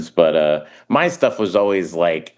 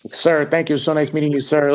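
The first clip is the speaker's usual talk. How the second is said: higher, gushing starstruck thanks; 9 semitones higher than usual. Retold or imitated. Comedian meeting Al Pacino, starstruck and gushing. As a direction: fawn over him like a starstruck fan. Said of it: "I was so starstruck".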